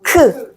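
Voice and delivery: 크 said with a strong puff of air; aspirated 크 with extra air; aspirated Korean k. Shown as a demonstration. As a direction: breathe hard through 크. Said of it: "This is aspirate sound with more air".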